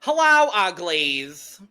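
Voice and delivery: slow, drawn-out drag queen greeting; Cynthia Doll impression. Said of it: "so I will do my Cynthia Doll impression".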